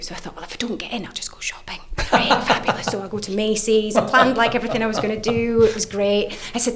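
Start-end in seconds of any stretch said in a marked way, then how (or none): 0.4-1.8 whispered aside, breathless and secret. Retold or imitated. To herself, whispering about going shopping instead. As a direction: drop to a sly little whisper.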